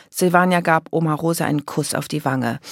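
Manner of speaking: plain reading voice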